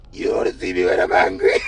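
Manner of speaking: very deep mock threat; hammed-up deep menace; angry man. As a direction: imitate an angry man in a put-on low voice. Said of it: "what kind of angry man this".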